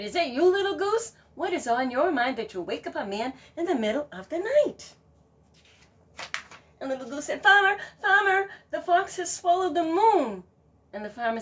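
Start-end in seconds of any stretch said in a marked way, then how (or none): 0.0-4.9 loud, higher exasperated complaint; a sleepy man fed up; grumpy farmer. Acted. Farmer complaining to the goose, exasperated. 7.4-10.4 high, loud alarmed call; urgent goose. Acted. Goose alerting the farmer, urgent and high.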